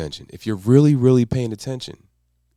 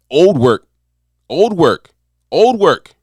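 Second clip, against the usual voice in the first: louder and higher with each repeat; building emphasis; 5 semitones higher, 7 dB louder, much slower than usual. Shown as a demonstration. Coach drilling the point to new viewers, building louder. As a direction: hammer the phrase home three times. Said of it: "chanted emphasis building".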